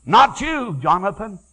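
high, loud repeated not you; rising refusal. Retold or imitated. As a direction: refuse him with rising force. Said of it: "he said".

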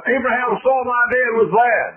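high, loud gospel storytelling; preaching. Acted. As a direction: tell the scripture at full force.

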